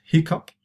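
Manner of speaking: "hiccup" ending like the word "up"; hiccup pronunciation. Shown as a demonstration. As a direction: say "hiccup" with a final "up". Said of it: "It's pronounced".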